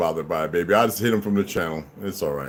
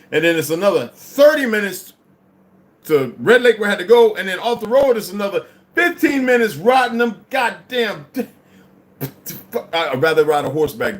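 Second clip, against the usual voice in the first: high, loud worked-up storytelling; excited rant; 8 semitones higher, 5 dB louder than usual. Real, not acted. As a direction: rant about the drive, all fired up. Ranting about the long drive.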